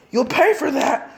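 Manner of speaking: high, loud, slow vow of revenge; furious threat. Acted. Enemy ninja threatening Naruto after Dosu dies, slow furious yell. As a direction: spit out the threat.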